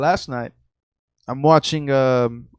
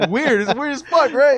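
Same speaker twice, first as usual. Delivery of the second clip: nervous laugh; 12 semitones higher, 5 dB louder than usual. Real, not acted. Nervous after ghost story.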